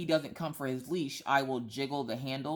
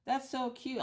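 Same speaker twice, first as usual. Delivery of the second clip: cooing lady; 9 semitones higher, slower than usual. Retold or imitated. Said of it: "and she goes".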